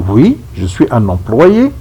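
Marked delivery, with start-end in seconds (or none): none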